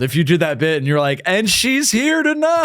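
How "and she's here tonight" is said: high, slow stage announcement; announcer voice. Retold or imitated. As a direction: announce it like a host. Mock comedian stage announcement.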